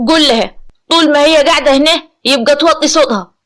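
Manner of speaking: loud, firm telling-off; stern order. A narrator's reading. Acted stern order about her voice.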